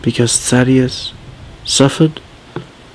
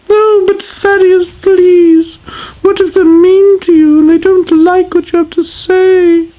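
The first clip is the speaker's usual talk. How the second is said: high wailing wife; 4 dB louder than usual. Acted. Sadeas pleading with her husband, high and wailing. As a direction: wail it like a dramatic queen.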